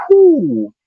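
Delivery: high, loud whew; relieved whew. Real, not acted. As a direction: blow out in relief.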